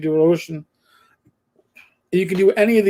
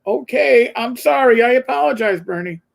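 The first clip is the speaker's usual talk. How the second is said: higher-pitched mock apology, falling away on the name; sheepish retold apology; 6 semitones higher, 3 dB louder than usual. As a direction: act out backing down, pitched up. Retold apology to scolding bartender.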